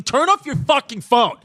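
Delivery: irritated snap at a friend; angry. Real, not acted. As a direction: bark the order, annoyed. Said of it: "You got angry".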